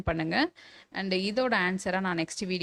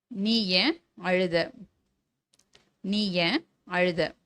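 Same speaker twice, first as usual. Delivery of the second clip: slow dictation; much slower than usual. Shown as a demonstration. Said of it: "teacher dictates a model sentence".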